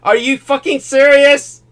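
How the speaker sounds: stunned at the injury news; shocked disbelief. Real, not acted. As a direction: blurt it out in real shock.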